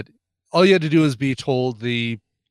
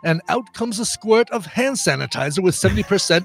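raised, fussy, exasperated quartermaster; John Cleese as Q; 4 semitones higher than usual. Acted. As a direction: primly lecture the careless agent. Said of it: "I'm doing the John Cleese".

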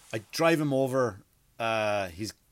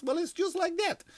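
mock Russian nurse, jumping up high at the end; Russian accent. Russian nurse explaining the needle to a boy, Russian accent. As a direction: imitate a Russian woman, ending in high pitch. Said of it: "with a Russian accent".

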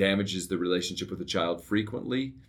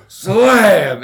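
door slam imitation; 13 semitones higher, 17 dB louder than usual.

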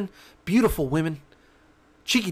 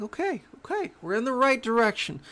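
pleased; 6 semitones higher than usual. Pretend. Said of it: "you're like".

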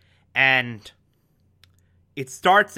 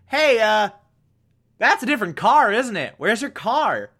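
higher, pointed questions with mock suspicion; suspicious Stan; 6 semitones higher than usual. Stan grilling Philip about the car, mock suspicious and higher. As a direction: push each question up like a wary cop.

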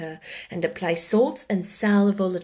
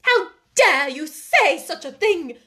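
slow, higher-pitched outrage; scandalised and drawn out; indignant exclamation; 7 semitones higher, 4 dB louder than usual. A narrator's reading. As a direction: exclaim it like an affronted headmistress. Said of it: "exclaimed Miss Minchin".

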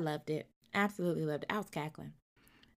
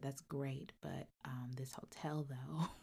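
a mock whisper, mostly breath and much softer; hushed aside; 5 semitones lower, 11 dB quieter, slower than usual. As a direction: lean in and murmur it secretly. Asking fortune teller for directions.

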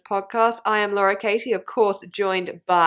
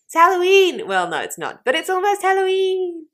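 excited; 11 semitones higher, 5 dB louder than usual. Real, not acted. Excited Halloween announcement.

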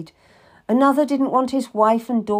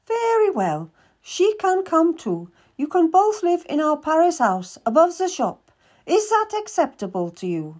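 a much higher, brisk merchant woman's voice; Madame Marchand; 8 semitones higher than usual. Acted. Madame questions the boy seeking work, higher businesslike voice. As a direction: play a shrewd, businesslike older lady.

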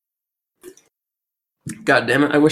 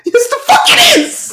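high, loud outburst; exasperated shout; 5 dB louder than usual. Real, not acted. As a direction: yell it back to win the argument. Heated argument over Spanish word.

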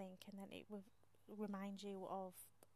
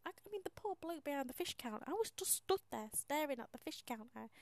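usual speaking voice

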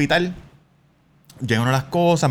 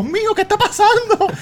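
much higher, laughing panicked woman's voice; alarmed coworker; 5 dB louder than usual. Retold or imitated. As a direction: play her panic while laughing. Coworker hears siren. (compañera asustada, voz aguda y riendo)

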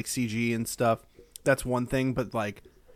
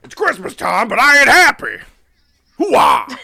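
loud, slow, high gruff shout; loud gung-ho soldier, Hoo-ah; Al Pacino impression; 10 semitones higher, 12 dB louder, slower than usual. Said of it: "Charlie Brown, played by Al Pacino".

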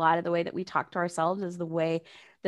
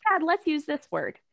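gentle parent; 7 semitones higher than usual. Retold or imitated. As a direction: coax the little one kindly, a touch higher. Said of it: "you just kinda gently".

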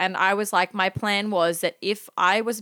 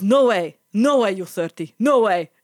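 loud, accented mock disbelief; incredulous old man; 6 dB louder than usual. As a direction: imitate the old driver's astonishment. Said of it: "this creepy old man".

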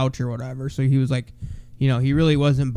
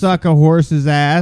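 slowed and louder to make it clear; slow clear repeat; 5 dB louder, slower than usual. Shown as a demonstration. Repeating the song lyric slowly so his friend can hear it, clear and loud. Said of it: "What's the ass part?".

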